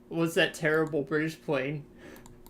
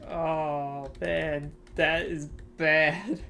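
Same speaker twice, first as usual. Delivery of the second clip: usual speaking voice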